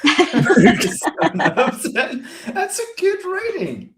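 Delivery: high laughing mock defence. Real, not acted. Defending his low rating, laughing.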